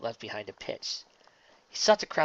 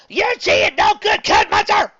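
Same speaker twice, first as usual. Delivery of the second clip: high, loud furious bellow; 15 dB louder than usual. Acted. Applejack bellowing at the cheating pitcher, furious shout. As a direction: bellow at the umpire. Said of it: "Applejack bellowed".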